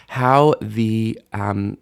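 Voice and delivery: normal talking voice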